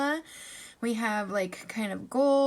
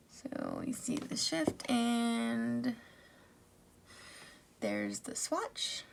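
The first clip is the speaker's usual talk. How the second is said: whispering; 7 dB quieter, slower than usual. Real, not acted. Whispered swatch commentary.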